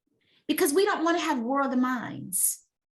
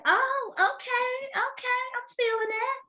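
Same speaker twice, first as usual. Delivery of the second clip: mock impressed; 13 semitones higher than usual. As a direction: play along, exaggeratedly impressed. Her mock-impressed reply voiced.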